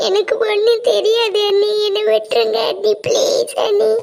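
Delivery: high-pitched, sobbing plea; tearful pleading. Acted. Girl begs sister-in-law to let her go, crying. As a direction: beg desperately through tears.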